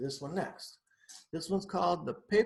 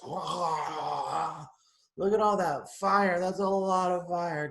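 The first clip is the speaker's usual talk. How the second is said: amazed; 3 semitones higher, 7 dB louder, much slower than usual. Real, not acted. Reading to kids, fire scene.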